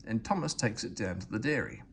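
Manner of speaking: ordinary delivery, nothing marked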